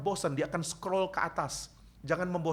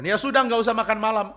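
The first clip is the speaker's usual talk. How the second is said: much higher, louder scolding mother; stern mom; 8 semitones higher, 9 dB louder than usual. Retold or imitated. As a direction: snap back at the complaining son. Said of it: "Mama saya akan mengatakan". (mama memarahi anaknya, suara tinggi dan keras)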